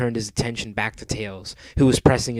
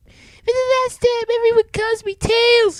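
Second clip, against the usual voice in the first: squeaky, very high, loud cartoon kid; high-pitched indignant little fox; Tails; 9 dB louder than usual. A narrator's reading. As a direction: protest in a tiny high voice. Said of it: "he grumbled".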